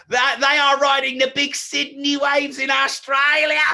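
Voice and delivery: high-pitched, hammed-up Australian accent; terrible Australian accent. Speaker joking about big Sydney waves, in a terrible Australian accent. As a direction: put on a bad, exaggerated Aussie accent. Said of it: "That was a terrible accent".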